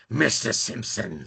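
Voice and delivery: slow, raspy cartoon impression; really raspy voice. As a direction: rasp it out slowly, imitating the character. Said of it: "like that really raspy voice".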